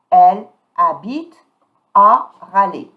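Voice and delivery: "Elle habite" loud, starting "habite" on the vowel; mute H. Shown as a demonstration. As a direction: model "habite" with silent H and E, pause before "à Raleigh". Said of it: "H is mute".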